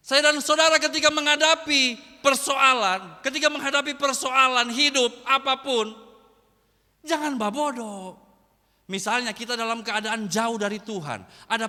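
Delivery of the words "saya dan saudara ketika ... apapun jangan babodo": loud, driving sermon delivery with a punch on each kau; pounding out kalau kau like a revival preacher; full-force preaching. Acted. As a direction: preach it hard, building the rhythm.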